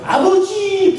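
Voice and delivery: high, clear call of father; hopeful call. Shown as a demonstration. Preacher shows an obedient child calling his father, high and clear.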